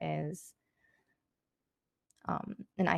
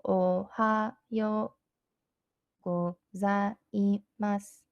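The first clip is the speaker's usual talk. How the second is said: slow "Ohayo gozaimasu", syllable by syllable; slow demonstration; 3 semitones higher, much slower than usual. Shown as a demonstration. Teacher showing learners how to say good morning, "Ohayo gozaimasu" slowly broken up. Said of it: "So let me say that slowly".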